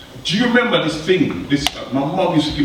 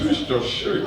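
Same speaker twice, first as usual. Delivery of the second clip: lower, softer suave Bond voice with a laugh; mock 007 introduction, lower and softer; Sean Connery impression; 7 semitones lower, 5 dB quieter, slower than usual. An actor showing off his Bond role in a low mock-suave voice. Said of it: "had to come in with Sean Connery touch".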